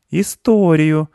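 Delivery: high, insistent one-word demand; demanding boy. A narrator's reading. Mark withholds nuts from squirrel, demanding. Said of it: "потребовал Марк".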